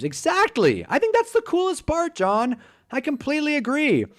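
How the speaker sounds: high-pitched enthusiastic agreement; real keen approval; excited agreement. Real, not acted. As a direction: agree eagerly, voice leaping up high. Enthusiastically agreeing with viewer John.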